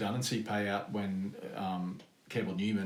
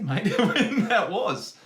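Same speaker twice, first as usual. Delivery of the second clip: laughing; 11 semitones higher, 10 dB louder, much slower than usual. Real, not acted. Laughing at own answer.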